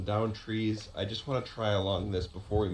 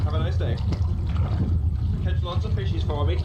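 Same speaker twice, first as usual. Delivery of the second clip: high, loud sing-song call across the water; calling out to loons; 8 semitones higher, 6 dB louder than usual. Real, not acted. Fisherman calling out to loons on the lake, high and loud sing-song. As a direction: hoot a cheerful high greeting to the birds.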